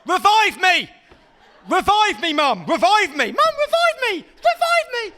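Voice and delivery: put-on high, loud frantic kid screaming to be revived; a fallen child shouting like a gamer; kid yelling in game lingo. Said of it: "He just went".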